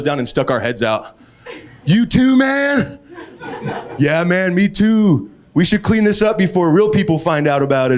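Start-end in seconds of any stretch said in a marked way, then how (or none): 1.8-3.0 high, loud, dazed stoner voice; a stoned guy greeting a fellow crasher; stoned driver voice. Retold or imitated. Stoned drivers greeting through windows. 4.0-8.0 a stoned guy agreeing about the cover-up; hint of a dazed stoner; stoned driver voice.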